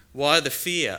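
loud, high challenging question. Acted. Jesus asks the disciples, loud and pointed. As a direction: call them out on their fear.

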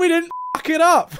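high, triumphant shout; excited cheer. Real, not acted. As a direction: crow it out in triumph. Celebrating result.

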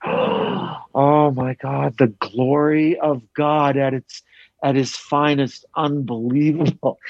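drawn-out mock awe; awestruck tourist. Woman marvelling to her kids at the glory of God, mock awe. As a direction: imitate a tourist overcome by the view.

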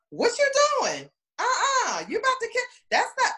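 high teasing outrage at the kiss; mock indignant. Twins teasing the boyfriends leaning in, high and laughing. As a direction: squeal it like you caught them. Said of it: "we were like".

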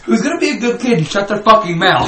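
put-on high, loud laughing baby talk; mocking pet talk. Uncle Sam to the kids, laughing high pet talk.

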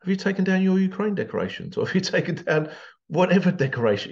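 sarcastic. Acted. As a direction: play dumb, dripping with sarcasm. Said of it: "Yes, it's sarcasm".

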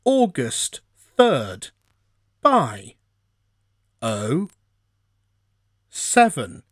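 slow, high automated readout; phone menu voice. Retold or imitated. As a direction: drag out each word like a voicemail robot. Automated voicemail reading date.

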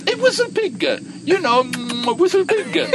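high, loud, squeaky biker voice; excited biker. Retold or imitated. The biker insisting to Raj, high and loud. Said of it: "smacks it a few times and goes".

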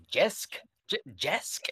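high questioning "Jesk?" for Jeff, said with an sh-like start; wife puzzling over the name, asking "Jesk?" in a high rising voice; mispronouncing Jeff as Jesk. Retold or imitated. Husband retells his wife's mispronunciation, a high puzzled "Jesk?". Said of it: "my wife still comes up to me and just like".

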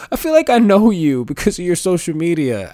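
mock overfamiliar fan gushing; imitating an eager stranger. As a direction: gush it like a fan who thinks they know you. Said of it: "people that I've had come up to me and go".

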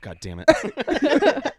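drawn out. Pretend. Drawn-out exclamation.

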